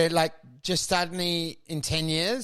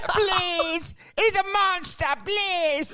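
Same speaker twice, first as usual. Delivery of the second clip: high, desperate pleading; high whiny android pleading; begging robot; 12 semitones higher, slower than usual. Acted. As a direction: beg as if your life depends on it. Robot begs not to return.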